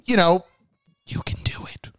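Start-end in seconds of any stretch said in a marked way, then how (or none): 1.1-1.9 soft, airy whisper; mock guardian angel whispering a pep talk; whispered encouragement. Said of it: "he's pretty much like".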